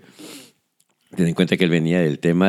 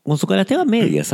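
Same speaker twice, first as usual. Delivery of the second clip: high-pitched; 14 semitones higher than usual. Acted. High sustained echo.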